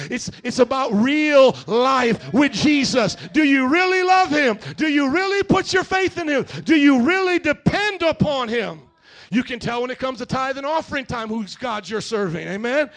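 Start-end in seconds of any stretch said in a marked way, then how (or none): 0.1-8.8 loud, high, pressing questions; full-force preaching. Acted. Pastor challenging the congregation's faith, loud preaching. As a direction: drive each question home hard.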